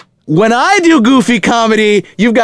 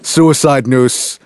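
spooky movie voice; 5 semitones lower than usual. Acted. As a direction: play it creepy and unsettled. Acting scared character from film.

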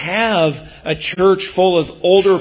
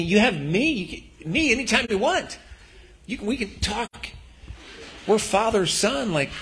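tender reassurance; 6 semitones higher, 6 dB quieter than usual. Acted. Father tenderly assuring son.

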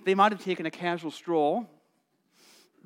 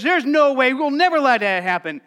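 firm protest; 5 semitones higher, 7 dB louder than usual. Acted. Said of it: "And Peter says".

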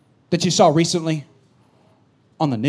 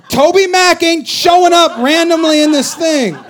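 usual speaking voice

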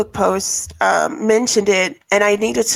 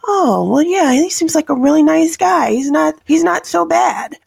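higher-pitched mock reassurance; imitated easygoing approval; 7 semitones higher, 3 dB louder than usual. Bar owner excusing a friendly newcomer, higher and mock-reassured. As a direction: lift the voice, playing someone easily won over.

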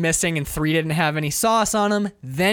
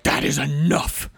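mock stern; 2 semitones lower than usual. Tom bangs hand down, retold.

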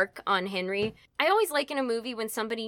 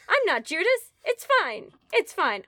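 high-pitched, protesting denial; mock self-reassurance; 8 semitones higher, 3 dB louder than usual. Guilty Henry convinces himself, mock anxious reassurance. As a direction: insist all is well, playfully panicked.

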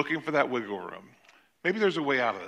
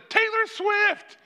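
put-on much higher, slow, drawn-out child chorus; kids' answer; 4 dB louder, much slower than usual. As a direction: sing it out like a room of little girls. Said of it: "all these girls said".

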